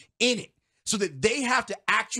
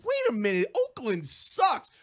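mock dismissive fan; 5 semitones higher than usual. Mimicking fans scoffing at Oakland, mock dismissive. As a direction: draw out the put-down, voice rising. Quoting fans' dismissive reaction.

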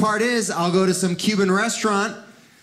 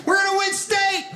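much higher, shouting glee; excited coach; 13 semitones higher than usual. Retold or imitated. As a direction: coach thrilled at a Cuban kid. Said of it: "The coach is like".

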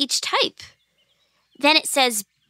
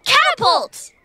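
high, louder eureka cry; excited idea; 12 semitones higher, 4 dB louder, slower than usual. Acted. Girl lands on a plan to drop the apple, excited shout. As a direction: burst out with a brainwave. Sudden idea for hitting Newton.

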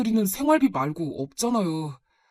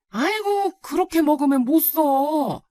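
high, drawn-out dismay; fussing grandmother; 7 semitones higher, slower than usual. Acted. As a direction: gently scold like a worried grandma. Grandmother fusses over poor meals. (걱정스레 나무라는 할머니 말투)

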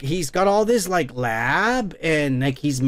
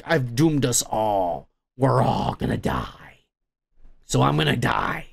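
retold doom; 6 semitones lower than usual. Retelling a scientist's last words before he jumps, mildly acted doom.

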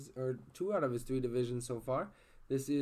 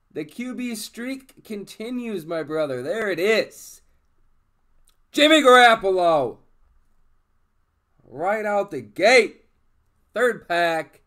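loud and high, still buzzing; excited; 7 semitones higher, 16 dB louder than usual. Real, not acted. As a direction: ride the leftover hype. Still hyped after big hit.

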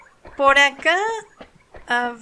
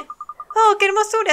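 high, bright cry of delight; 8 semitones higher, 4 dB louder, slower than usual. Acted. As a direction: gush over the flower. Girl delighted by the flower.

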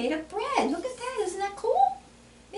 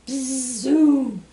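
loud drawn-out buzzing zoom; bug zooming; 6 dB louder than usual. Retold or imitated. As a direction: zoom past like a flying bug. Buzzing noise for the bugs.

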